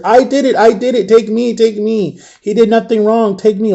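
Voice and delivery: high, desperate retold begging; mock-frantic repeated plea; imitated scared pleading. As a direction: beg the officer in a high voice, imitating a scared friend. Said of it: "she was so scared".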